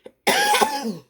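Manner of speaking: a real dry cough from an itchy throat. Real, not acted. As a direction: cough hard once, then stop. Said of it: "Sorry about the coughing".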